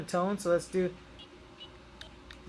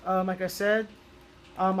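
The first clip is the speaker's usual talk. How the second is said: usual speaking voice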